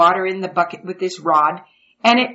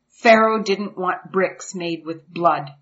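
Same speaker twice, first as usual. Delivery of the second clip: usual speaking voice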